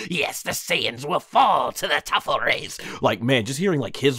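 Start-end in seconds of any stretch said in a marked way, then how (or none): 0.1-2.8 higher-pitched cartoon villain voice; hammy villain declaration, pitched up; Baby impression. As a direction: proclaim it as a triumphant villain. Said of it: "he killed it as Baby".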